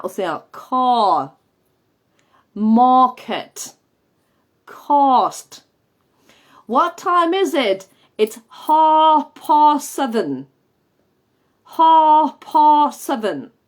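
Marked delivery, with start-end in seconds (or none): 0.5-5.6 "car, market, cost" on a very open back vowel; loud, long back A in "car, market, cost"; pija A. Shown as a demonstration. Teacher demonstrating the posh A, "car, market, cost" open and far back. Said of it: "estás muy abierta y muy trasera". 6.7-13.5 "half past" very slow, high and loud with open back A; pija A. Shown as a demonstration. Teacher demonstrating the pija vowel, "half past" hugely drawn out. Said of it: "muy abierta y muy trasera".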